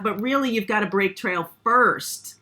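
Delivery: usual speaking voice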